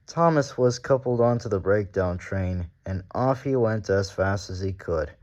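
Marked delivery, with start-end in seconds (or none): none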